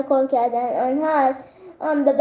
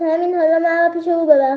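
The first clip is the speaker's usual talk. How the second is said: ordinary delivery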